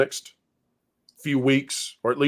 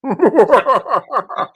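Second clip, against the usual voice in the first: high bursting laugh; real laugh at the bit; 10 semitones higher, 4 dB louder than usual. Real, not acted. Laughs at own impression.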